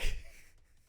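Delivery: short breathy burst of laughter; real laugh. Real, not acted. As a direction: laugh out loud at your own voice. GM cracks up at own voice.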